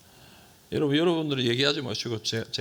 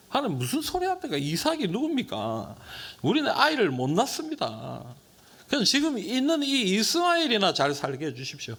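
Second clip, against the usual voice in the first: much higher, disbelieving plea; incredulous protest; 7 semitones higher than usual. Retold or imitated. Abraham protesting God's promise, high and incredulous. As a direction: protest to God as if it is absurd. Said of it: "뭐라고 얘기하냐면".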